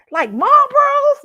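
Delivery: high, loud, drawn-out disbelief; retold appalled double-check; incredulous question. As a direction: shriek the question in mock shock. Retold disbelief at cigarette brand.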